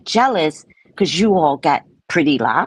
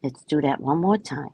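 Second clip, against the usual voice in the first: usual speaking voice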